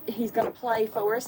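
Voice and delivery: normal talking voice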